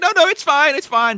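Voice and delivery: high, loud, frantic insistence; mock panicked reassurance. Co-host voices the builder's feelings while making it, mock panic. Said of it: "the feeling you had while making it".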